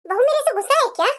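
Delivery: ordinary talk, nothing marked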